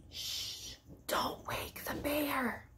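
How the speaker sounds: hushed, slow whisper; whispered title. Shown as a demonstration. Reader announcing the title, slow hushed whisper. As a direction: shush them like a sleeping bear is near. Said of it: "book title said hushed".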